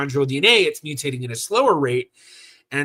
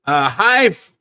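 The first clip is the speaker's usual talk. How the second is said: usual speaking voice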